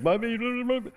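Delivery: high mocking blah blah babble. Jeremiah's excuses mocked as high whiny blah blah. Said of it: "he was like".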